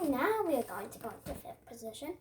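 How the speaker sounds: ordinary talk, nothing marked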